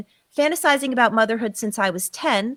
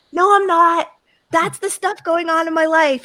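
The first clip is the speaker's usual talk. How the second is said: higher, louder denial, pushing back; defensive protest; 5 semitones higher, 4 dB louder than usual. Retold or imitated. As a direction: insist you're not lying, voice up. Protesting to the inner voice.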